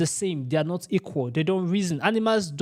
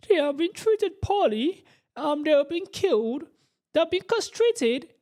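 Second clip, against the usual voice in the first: mock teary pity voice; 10 semitones higher than usual. Mocking the vegan lady's tone, high and tearful. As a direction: mimic a woman on the verge of tears, hammed up. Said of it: "Sounding as if she wants to cry".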